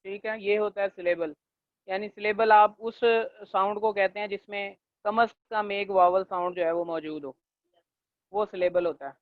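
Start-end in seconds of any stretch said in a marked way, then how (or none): none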